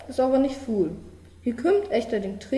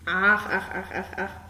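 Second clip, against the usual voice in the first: frog croak; 3 semitones lower than usual. A narrator's reading. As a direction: croak each ach like a frog by the pond. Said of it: "Die Poggen blieft over de Bi". (leises Froschquaken)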